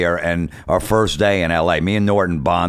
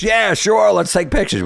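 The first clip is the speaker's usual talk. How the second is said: much higher, louder put-on voice; high-pitched imitation of sudden agreement; Dice; 4 dB louder than usual. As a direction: blurt an eager yes in a cartoonish impersonation.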